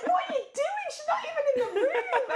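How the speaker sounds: high, loud, giggling mock outrage; laughing disbelief. Telling host how she found his wife cleaning, laughing incredulous. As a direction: squeal the question through laughter.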